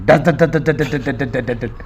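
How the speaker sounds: rapid mouth gun rattle, slow; gunfire imitation. Gunfire after shooting poem. (بندوق کی گولیوں کی نقل)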